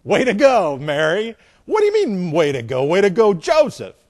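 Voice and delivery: loud, high, sarcastic back-and-forth blame; mock bickering. Joseph and Mary blame each other, hammed-up bickering. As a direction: throw the blame back with comic outrage.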